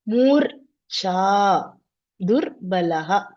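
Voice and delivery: "murcha, durbalaha" slowly, parting after each r; sound-by-sound demonstration. Shown as a demonstration. Teacher showing the ra sign, "murcha, durbalaha" slowly split at the r. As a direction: draw out "murcha, durbalaha": mur, cha, dur, balaha. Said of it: "Make a ra sound and come down".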